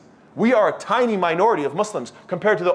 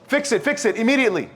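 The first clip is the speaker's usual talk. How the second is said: mock screaming; 6 semitones higher than usual. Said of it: "People start screaming out".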